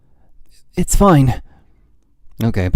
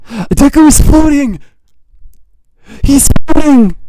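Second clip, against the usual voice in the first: high, loud burst of fan excitement; excited squeal; 14 semitones higher, 7 dB louder than usual. Real, not acted. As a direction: shriek it with pure fangirl joy. Excited fan sees Deku float.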